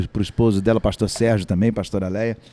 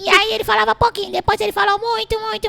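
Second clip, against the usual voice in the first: squeaky voice, fast and far higher; high cartoon voice. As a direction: chatter on in the tiny buddy voice. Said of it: "comecei a fazer essa voz assim".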